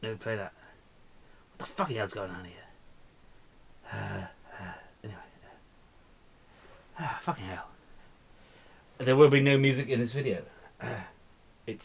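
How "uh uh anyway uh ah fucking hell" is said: hushed curse under breath; frustrated whisper. Real, not acted. Music won't play, whispered curse. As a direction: curse quietly to yourself.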